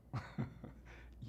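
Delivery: soft knowing chuckle; private chuckle. Acted. Old guy hinting at past partners, private chuckle.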